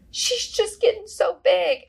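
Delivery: high, drawn-out mock weepy voice; imitated sobbing. Coworker gushing over a sleeping child, imitated sobbing. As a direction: choke up dramatically, dragging the words out high. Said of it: "are you sobbing right now".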